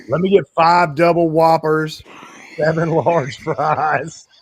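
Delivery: high, loud, slow put-on drawl; Hitman voice. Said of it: "I'm gonna show you how simple it is to sound like Hitman".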